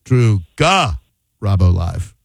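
"Drew Ga", a pause, then low "Robbo Live"; split name. Shown as a demonstration. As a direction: break "Garabo" in two after "Ga". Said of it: "I'm gonna say it another".

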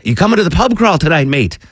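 light English-accent impression of a coworker. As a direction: mimic English Nick calling you mate. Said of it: "I'm not gonna do his accent".

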